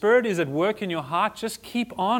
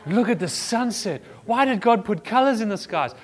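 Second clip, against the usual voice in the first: awed wonder; 5 semitones higher than usual. Retold or imitated. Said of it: "the wowzers of the world".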